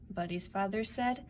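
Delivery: ordinary delivery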